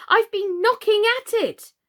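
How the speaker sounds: insist, exasperated; annoyed. A narrator's reading.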